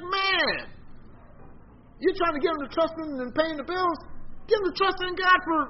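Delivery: incredulous, rising to a near-shout at the friend; exasperated retort. Retold or imitated. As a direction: talk him down, high and exasperated.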